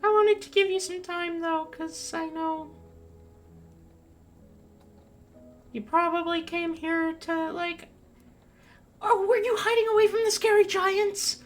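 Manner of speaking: high, small creature voice; tiny spider voice. Acted. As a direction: speak like a young spider, gently curious. Young spider speaks to druid.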